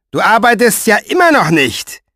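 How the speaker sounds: high, loud furious reproach; angry Pfeifer. A narrator's reading. Pfeifer with sword at Mo's chest, loud and furious. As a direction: jab the words like the sword.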